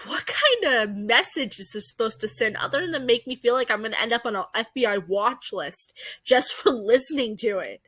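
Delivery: ordinary talk, nothing marked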